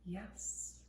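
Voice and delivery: soft, gently welcoming yes; accepting yes. Shown as a demonstration. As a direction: say yes quietly, letting it in. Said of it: "this is gonna be more like a".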